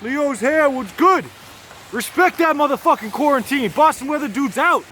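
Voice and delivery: high, loud shout-out; hyped sign-off. Real, not acted. Vlogger signing off to viewers, hyped. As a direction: belt out the crew's sign-off with swagger.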